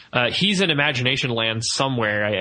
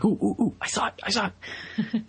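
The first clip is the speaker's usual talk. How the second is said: excited fan squeal; 8 semitones higher, 4 dB quieter than usual. Pretend. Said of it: "be like".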